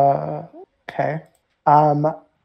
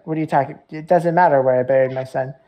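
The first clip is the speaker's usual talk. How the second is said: usual speaking voice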